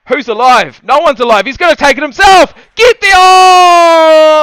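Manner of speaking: rising panic, a full yell that peaks on oh; excited shouting. Real, not acted. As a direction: gamer yells at his quarterback as the play collapses. Shouting at the video game.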